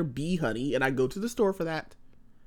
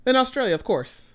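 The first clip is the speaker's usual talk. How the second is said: sarcastic; 10 semitones higher, 5 dB louder than usual. Retold or imitated. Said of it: "I was like".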